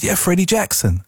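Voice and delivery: imitating a smooth American singer on the phone, half-managed; attempted American accent. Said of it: "I'm trying to do the accent".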